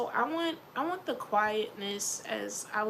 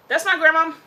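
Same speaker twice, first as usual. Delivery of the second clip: much louder, higher, declaring it; loud proud insistence; 6 semitones higher, 13 dB louder than usual. Real, not acted. Insisting to the audience that she is her grandma, loud. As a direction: proclaim it like a boast.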